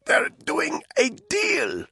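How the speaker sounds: very high, slow strained croak. A narrator's reading. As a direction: squeeze the words out through a tight throat. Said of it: "she croaked".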